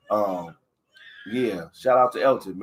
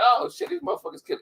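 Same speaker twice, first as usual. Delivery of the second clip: younger self squeaking in shock, pitched way up; mock-overwhelmed kid's voice, much higher; stunned kid reacting to a roasting; high-pitched retold thought; 13 semitones higher than usual. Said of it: "I'm like".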